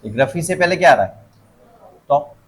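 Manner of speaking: ordinary talk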